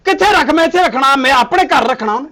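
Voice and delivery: loud and high, insisting hard; angry shout. Acted. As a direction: shout down her objection. Heated argument over the second wife.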